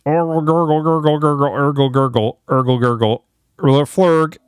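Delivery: drowning gurgle noises; gurgling alien voice. Pretend. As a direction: gurgle like going under water.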